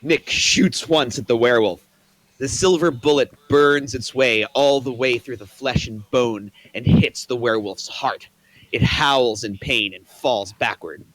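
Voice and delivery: ordinary delivery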